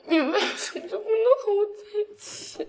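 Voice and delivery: tearful plea with stress on 能; crying. Acted. Girl cries out her question.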